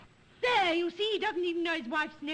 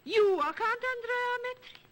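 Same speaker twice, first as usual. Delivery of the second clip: shocked; 6 semitones higher, 3 dB louder than usual. Acted. Shocked at the false count.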